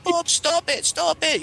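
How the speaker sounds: high, urgent, repeated plea; pleading mom. Retold or imitated. Mom breaking up the fight, a high, urgent plea to stop. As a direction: beg him to stop like a frantic mother.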